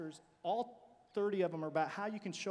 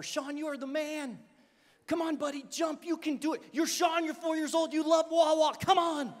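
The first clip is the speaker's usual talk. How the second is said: higher, louder coaxing cheer; 6 semitones higher, 7 dB louder than usual. Retold or imitated. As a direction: urge the boy to jump. Coach cheering boy from water.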